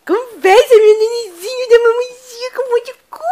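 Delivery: put-on high squeaky baby talk with laughs; squeaky praising a dog. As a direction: coo high and silly. Cooing at baby.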